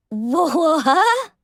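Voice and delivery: a sudden high, startled blurt; snapped out of a daydream; startled what. Acted. Young pony caught staring at her crush, startled.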